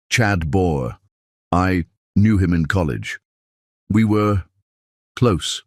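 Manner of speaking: halting, measured confession with pauses; careful. A narrator's reading. Mother admitting her college romance to her daughter, carefully. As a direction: feel out each phrase before saying it. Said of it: "Hallie said carefully".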